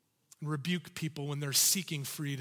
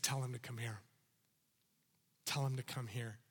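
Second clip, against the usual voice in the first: calm command; 7 semitones lower, 9 dB quieter, faster than usual. Acted. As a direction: lower and much softer, gentle call. Jesus voiced calmly and low.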